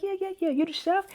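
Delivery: imitated high, eager recognition. Man at the event recognizing the chef, eagerly.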